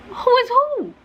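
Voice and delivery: very high, baffled; confused squeak. Retold or imitated. Teller imitating her confused reply to grandma, very high. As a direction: squeak it out in disbelief.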